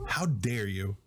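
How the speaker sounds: mock dramatic indignation. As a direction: drop the voice and play offended, jokingly. Host repeats mock scolding, lower.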